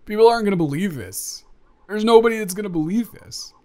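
high, loud and fast, bursting with excitement; excited disbelief. Real, not acted. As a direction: blurt it out, thrilled and incredulous. Insisting nobody will believe it.

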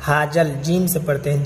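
ordinary talk, nothing marked

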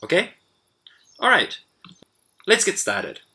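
ordinary talk

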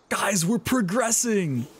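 high, drawn-out cheer to the group; excited announcement. Real, not acted. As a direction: announce good news slowly and high, thrilled. Celebrating progress to viewers.

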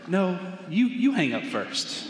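lightly imitating a lovestruck teen, drawn out; lovesick teenager. Teen on a long-distance call to a sweetheart, playfully lovesick. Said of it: "silly things that all teenagers heavily in love would do".

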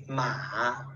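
"ma" drawn out, falling then rising; teacher modelling third-tone "ma". Shown as a demonstration. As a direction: slowly dip and lift "ma". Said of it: "the third one is ma".

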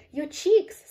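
high, loud burst of alarm; sudden panicked high cry; scream. A narrator's reading. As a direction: scream out in shock. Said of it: "screamed Missus Beauregard".

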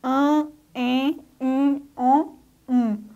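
an, en, in, on, um each drawn out as clear nasal vowels; teacher modelling nasal vowels; nasal vowels demo. Shown as a demonstration. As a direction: sound out an, en, in, on, um slowly through the nose. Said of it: "Por exemplo".

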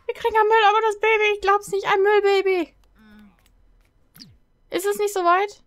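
high, loud comic hysteria; frantic, high-pitched outburst; hysterical panic. Real, not acted. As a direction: squeal it in disbelief. Repeats it in rising panic.

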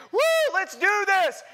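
high, loud whoop of excitement; hyped thrill-seeker shout; pumped-up rider. Acted. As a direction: whoop it like you're raring to ride. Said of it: "they're pumped".

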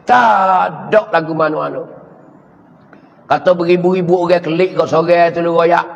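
ordinary talk

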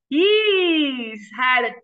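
ordinary delivery, nothing marked

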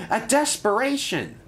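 DESPERATION proclaimed very high, falling at the end; eager wrong word. A narrator's reading. Percy proposes the wrong word, DESPERATION cried high and eagerly. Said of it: "It's a deputation, not a desperation".

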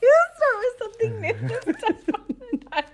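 laughing. Real, not acted. Laughing during word game.

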